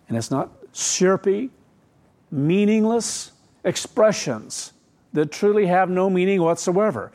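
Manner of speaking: ordinary talk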